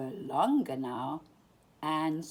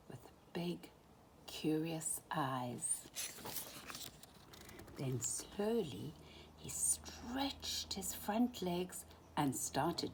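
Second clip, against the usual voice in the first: slow hushed whisper; softly, as if not to wake him; 8 dB quieter, slower than usual. A narrator's reading. Hushed narration of the fawn.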